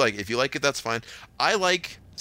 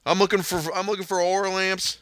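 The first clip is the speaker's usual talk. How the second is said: higher-pitched Southern customer, oil as "all"; Southern accent; 7 semitones higher than usual. Retold or imitated. Store worker retells a customer's request, thick Southern accent. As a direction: mimic a hard-to-follow Southern shopper. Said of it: "I couldn't understand him".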